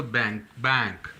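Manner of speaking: बैंक with nasal ng, like bank; model pronunciation. Shown as a demonstration. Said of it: "नून गुन्ना के sound देगा".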